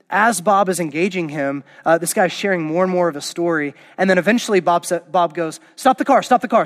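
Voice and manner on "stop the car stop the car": higher, louder urgent retold command; urgent mock shout. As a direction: bark it like Bob stopping the limo. Bob urgently orders the limo stopped.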